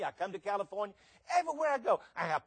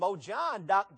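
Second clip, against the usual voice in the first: usual speaking voice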